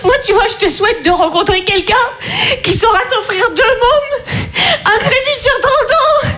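hysterical laughing rant. Acted. Tired mother lecturing her carefree friend about happiness, laughing hysterically. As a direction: build from a scoff to an unhinged laugh.

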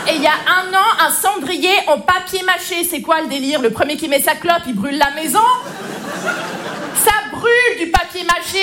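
high, worked-up scolding rant; mock tirade. Aunt berating her little nephew over dangerous gifts, exasperated. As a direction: tear into him with comic outrage, pitch climbing.

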